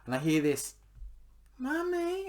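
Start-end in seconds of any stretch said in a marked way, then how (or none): 1.6-2.3 put-on high little child's voice calling. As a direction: call out like a small kid. Child calls from corridor.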